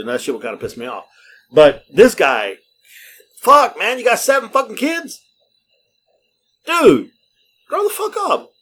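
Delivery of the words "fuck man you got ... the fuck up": exasperated rant. Real, not acted. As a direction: vent at him, fed up. Ranting at the bragging father.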